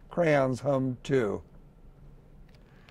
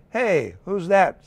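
crayon voice; 5 semitones higher, 6 dB louder than usual. A narrator's reading.

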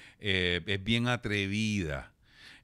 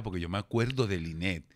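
usual speaking voice

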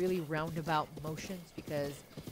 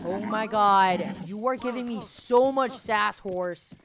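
exasperated; 8 dB louder, slower than usual. Real, not acted. Exasperated at horse.

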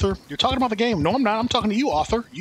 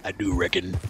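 cowboy accent; 9 semitones lower than usual. Shown as a demonstration. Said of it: "I actually do say".

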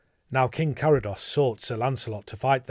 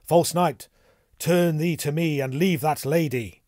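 loud, raised command to a villain; challenging cry; 5 semitones higher, 6 dB louder than usual. A narrator's reading. Gawain defends a lady from Sir Bruce, crying out. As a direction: cry out to confront him. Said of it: "between Sir Bruce and the lady and cried".

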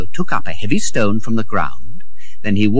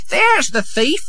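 high-pitched woman's outcry; shrieky alarm without much volume; crying out. Acted. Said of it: "she cried".

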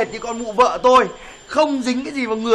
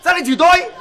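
angry demand; 8 semitones higher, 4 dB louder, faster than usual. Acted. Men quarrel over an insult.

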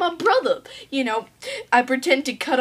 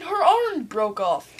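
usual speaking voice

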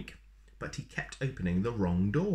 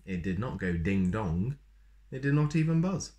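usual speaking voice

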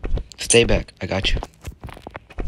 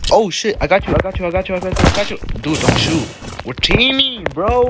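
high pleading voice; 5 semitones higher than usual. Real, not acted. Pleading to team with enemy.

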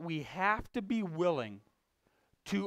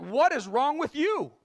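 louder, higher, throwing the question right back; angry retort; 9 semitones higher, 7 dB louder than usual. Retold or imitated. Retelling how he snapped back at coworker Tony, angry retort. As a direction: snap it back at him.

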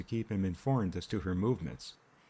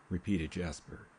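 ordinary delivery, nothing marked

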